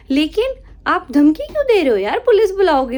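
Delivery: loud, rising indignant challenge; angry retort. Retold or imitated. Shopkeeper confronting rude customer, loud and indignant. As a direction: push back hard at the threat.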